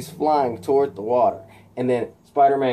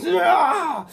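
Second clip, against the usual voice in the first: very high battle cry; high rolled villain scream; Electro's attack scream. Acted. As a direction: scream as you fire a blast. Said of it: "and he goes".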